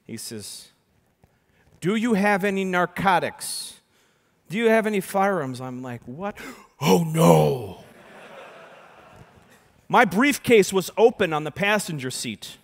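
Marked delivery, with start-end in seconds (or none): 1.8-5.6 stern constable. Retold or imitated. Constable questioning in retold story. 6.1-7.8 shocked realization. Retold or imitated. Driver realizing the briefcase is open, gasping in shock. Sudden realization at police search.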